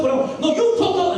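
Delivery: high, heated mock retort; imitated bickering, high and insistent; acted argument. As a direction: squabble back with the pitch climbing. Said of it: "we were going at it like that".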